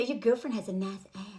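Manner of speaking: low, softly leering cartoon seducer; Pepe Le Pew.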